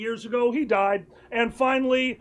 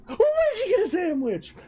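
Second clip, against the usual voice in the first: much higher, delighted squeal, laughing; gleeful imitation; 9 semitones higher than usual. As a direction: mimic a boyfriend thrilled to spot a sandwich. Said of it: "with eyes of glee".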